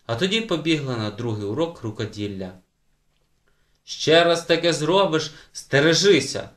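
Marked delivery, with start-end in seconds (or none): 3.8-6.5 loud, raised-pitch warning; shouted threat. A narrator's reading. Brother threatening his sister, shouted warning. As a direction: call it out as a threat. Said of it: "гукнув Уле, пригрозивши кулаком".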